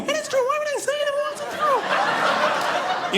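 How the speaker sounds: very high mock shouting; wife's argument voice. Wife argues with husband in a high squeaky mock shout. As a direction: squeal back in a fake quarrel. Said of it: "screaming matches".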